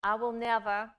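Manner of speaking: normal talking voice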